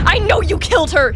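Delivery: high accusing cry; screeched. Acted. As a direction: hurl the accusation. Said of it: "she screeched".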